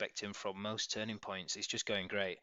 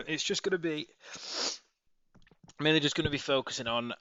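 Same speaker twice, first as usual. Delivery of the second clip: stuffy nose; 5 semitones higher, 6 dB louder than usual. Real, not acted. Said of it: "so if I sound a bit stuffy".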